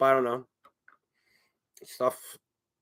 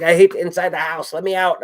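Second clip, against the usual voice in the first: raised, higher voice of a fed-up cat; demanding cat; 6 semitones higher, 7 dB louder than usual. Acted. Cat begging owner to let her out, demanding. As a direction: complain loudly to be let out.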